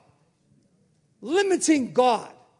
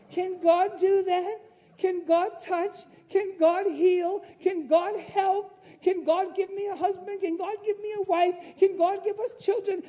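a hint of a worried church member; anxious questioner; 7 semitones higher than usual. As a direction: mimic a fretful believer, quavering.

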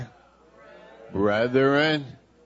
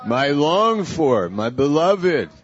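slow and louder, heartfelt; slow, fervent endearment; 5 semitones higher, 6 dB louder, slower than usual. Shown as a demonstration. Pastor demonstrates endearments to the congregation, slow and fervent. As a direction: call them your beloved with feeling. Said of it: "Say that to your neighbor".

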